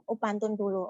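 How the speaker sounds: ordinary delivery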